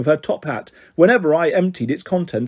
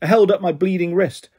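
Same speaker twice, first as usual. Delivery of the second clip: ordinary delivery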